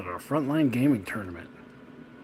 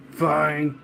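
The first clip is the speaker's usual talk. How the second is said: grudging; 4 semitones higher, 5 dB louder than usual. Retold or imitated.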